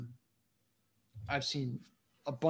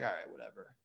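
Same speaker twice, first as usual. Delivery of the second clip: dismissive shrug; 10 semitones lower, 12 dB quieter than usual. Retold or imitated. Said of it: "I was like".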